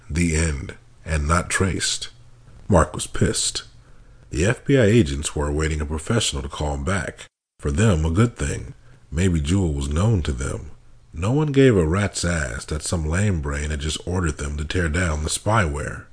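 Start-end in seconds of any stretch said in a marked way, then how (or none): none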